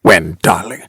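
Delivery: low, mocking echo of her question; impatient aping. A narrator's reading. Husband aping his wife's question, impatient mimicry. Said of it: "He apes her impatiently".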